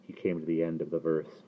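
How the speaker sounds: ordinary delivery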